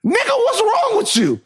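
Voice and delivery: very high, drawn-out scolding; incredulous dad. Acted. Dad asks boy crying over Batman, incredulous. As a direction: stretch it in high disbelief. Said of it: "my dad would look at me".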